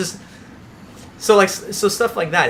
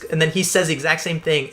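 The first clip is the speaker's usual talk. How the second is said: usual speaking voice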